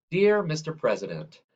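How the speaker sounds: ordinary delivery, nothing marked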